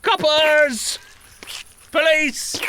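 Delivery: panicked yell for the police, high and loud; shouting for help. Acted. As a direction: call out for the police. Character yells for police.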